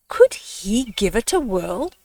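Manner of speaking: ordinary delivery, nothing marked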